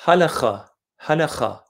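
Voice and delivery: Halakha with a throaty kh, stressed on the first syllable; Hebrew word. Shown as a demonstration. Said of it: "the word for Sharia in Hebrew".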